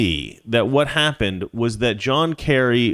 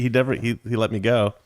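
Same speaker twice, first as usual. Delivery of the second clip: normal talking voice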